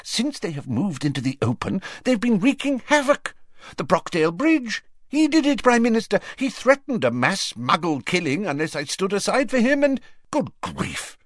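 high, urgent outpouring; worked-up, exclaiming minister; agitated. A narrator's reading. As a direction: pour out the bad news, pitched high. Agitated Fudge reports the attacks.

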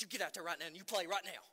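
fast, loud stern order; stern dad order. Retold or imitated. Father ordering his son back onto the court, fast and stern. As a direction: snap the order at the crying kid. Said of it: "I said".

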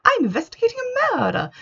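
very high, nasal mimicry of an annoying heroine; high-pitched nasal voice. Reviewer mocks the audiobook voice of her least favourite character, high-pitched and nasal. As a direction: put on a squeaky, nasal, irritating voice. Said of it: "high-pitched, very nasal kind of".

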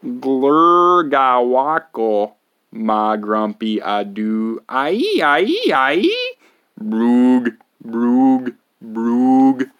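slow, loud nonsense babble with squeaks; silly gibberish. Acted. Reader forced to say the nonsense words, loud gibberish. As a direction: ham up the gibberish, wildly. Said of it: "make silly sounds".

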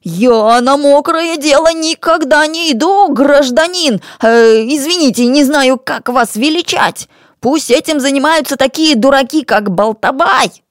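high-pitched, wheedling protest; high character voice of an offended crook; indignant shifty rogue. Acted. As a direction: protest your innocence, faltering into apology.

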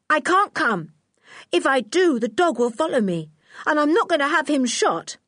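put-on George, a resolute child. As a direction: answer back in a high, insistent voice.

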